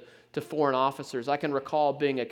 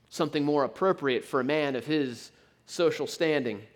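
ordinary talk

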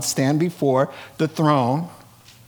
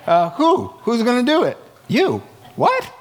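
incredulous Ahab; 7 semitones higher than usual. Acted. Ahab reacting to the prophet, laughing disbelief. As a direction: play Ahab stunned he's the one to fight. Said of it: "he's like".

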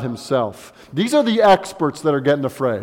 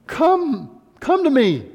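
gentle call; 9 semitones higher, slower than usual. Acted. God's voice, tender invitation.

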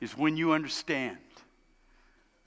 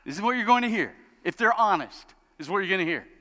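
ordinary talk

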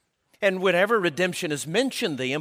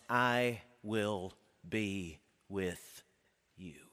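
tender hush; 8 semitones lower, 10 dB quieter, much slower than usual. Acted. Tender promise closing the point.

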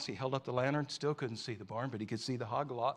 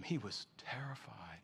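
slow, breathy whisper; hushed whisper; 3 semitones lower, 9 dB quieter, slower than usual. A narrator's reading. Preacher telling of the frightened boy, whispering. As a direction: let the fear sink in a whisper.

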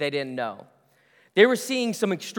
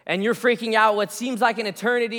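ordinary delivery

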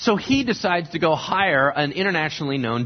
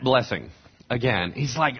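ordinary talk

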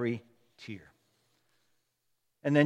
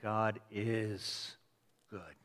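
soft, low, with a long "is" and a pause before good; quietly insist, stretching out the word "is"; stress on IS; 6 semitones lower, 13 dB quieter, much slower than usual. Shown as a demonstration. Believer telling himself despite not feeling it, hushed stress on "is". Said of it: "put the emphasis on the word "is".

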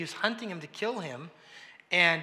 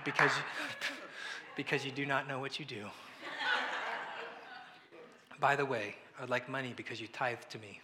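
laughing joke; 4 semitones lower, 5 dB quieter than usual. Pretend. Joking as Jesus to parent.

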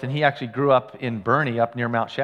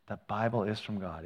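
soft repeated emphasis; 5 semitones lower, 11 dB quieter than usual. Shown as a demonstration. Pastor repeating the key line softly. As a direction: drop to a hushed repeat, stress on Bible. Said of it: "if you get one thing today".

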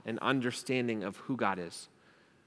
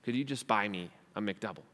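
lower, softer mumbled begging; lazy acquaintance asking; 5 semitones lower, 7 dB quieter than usual. Retold or imitated. A hungry acquaintance begging Sam at McDonald's, low and soft. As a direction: ask for a burger, low and lazy. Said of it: "this person says".

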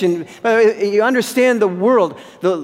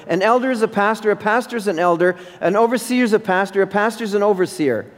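rapid-fire repetition; faster than usual. Acted. Preacher rattles off the equivalences.

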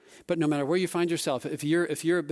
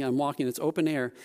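ordinary talk

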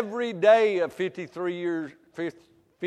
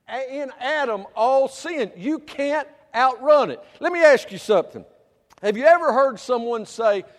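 raised, louder sermon voice; preaching; 7 semitones higher, 8 dB louder than usual. Acted. Preacher declaring all sin in Adam, loud. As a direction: push the point home to the pews.

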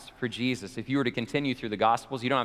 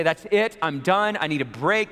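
mock fed-up outburst, louder and higher; exhausted outburst; 5 semitones higher, 5 dB louder than usual. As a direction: throw it up like someone at the end of their rope. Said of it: "Is he exhausted?".

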